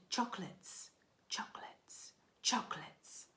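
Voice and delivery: hushed cave echo; an eerie echoing whisper. Acted. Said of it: "the voice from the cave replied".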